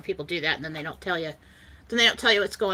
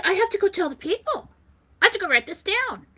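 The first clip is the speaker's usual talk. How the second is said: high-pitched eager rush; excited urgency; 11 semitones higher than usual. Retold or imitated. She must go tell the people, breathlessly eager. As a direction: burst out, bursting to share it.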